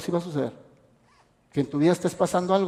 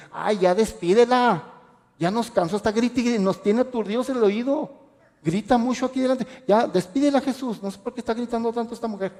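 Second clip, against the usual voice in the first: higher-pitched, fed-up grumbling, acted out; mock pestered plea to send her away; exasperated complaint; 5 semitones higher than usual. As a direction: imitate disciples tired of the noise. Acts out disciples complaining to Jesus.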